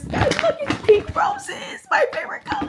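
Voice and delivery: high delighted coo to viewers; delighted gushing. Real, not acted. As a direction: swoon over the roses. Showing pink roses.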